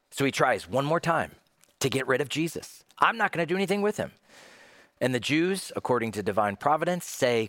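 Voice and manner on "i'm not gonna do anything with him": fast, high-pitched washing of hands; dismissive refusal. Acted. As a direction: refuse in a hurry, voice jumping up. Pilate trying to get rid of Jesus.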